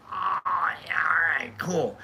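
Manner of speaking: soft, whispery dawning approval; acted-out breathy "now I get it"; hushed realization. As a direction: let it out as an impressed near-whisper. Imagined listeners' reaction, acted out.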